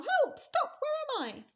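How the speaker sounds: very high, loud, dazed panic; tumbling crocodile crying for help; panicked cry. Acted. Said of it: "cried the crocodile".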